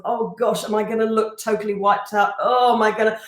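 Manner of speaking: ordinary talk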